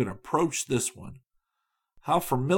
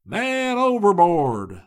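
raised, slow alarm call; appalling cry; 8 semitones higher, 6 dB louder, slower than usual. A narrator's reading. A sailor cries the alarm from forward, slow shout. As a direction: drag out the warning across the water. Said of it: "most appalling of all cries".

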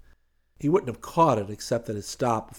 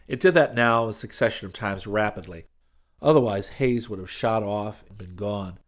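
plain reading voice

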